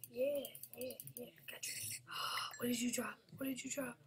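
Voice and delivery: ordinary delivery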